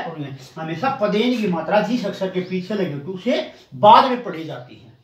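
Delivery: ordinary delivery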